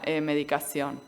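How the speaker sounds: normal talking voice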